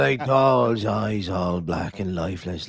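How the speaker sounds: slow, low, lightly voiced old sea dog; a hint of Quint spinning a grim tale; Jaws fisherman impression. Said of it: "He is doing that, uh, guy for Jaws".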